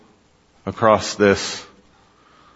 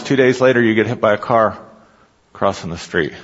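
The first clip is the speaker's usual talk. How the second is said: ordinary delivery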